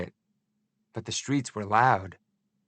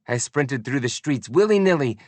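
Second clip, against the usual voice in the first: ordinary delivery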